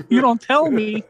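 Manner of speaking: exasperated retort. Retold or imitated. Retelling snapping back at coworkers who moved stuff, indignant.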